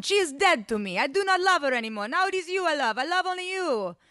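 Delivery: put-on high, impassioned accented declaration; hammed-up foreign Romeo; commanding lover. A narrator's reading. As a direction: declare it melodramatically, pitch soaring. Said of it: "commanded Ivan".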